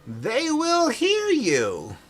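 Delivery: put-on very slow, high old man; slow, strange old miser; eerie old man voice.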